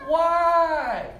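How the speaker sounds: loud whining why. Retold or imitated. Imagined girl asking why.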